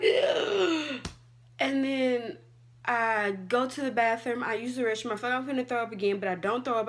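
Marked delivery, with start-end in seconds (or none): 0.0-1.0 high, loud groan with a gasp; pained groan. Retold or imitated. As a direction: groan in distress. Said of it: "I'm just all like".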